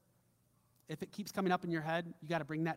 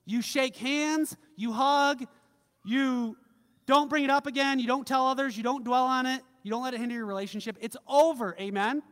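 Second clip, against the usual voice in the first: preaching at full force; 5 semitones higher, 7 dB louder than usual. Acted. Preaching climax.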